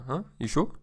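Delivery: goading, rising question; playful nudge with a lifted ending; pushing. Shown as a demonstration. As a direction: coax a friend into another drink. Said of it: "you're kinda pushing him".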